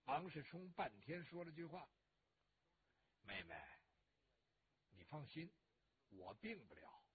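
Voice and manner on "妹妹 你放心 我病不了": very low, hushed, grief-weighted voice; subdued reassurance. A narrator's reading. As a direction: reassure her quietly after long silence. Grieving brother finally answers his sister.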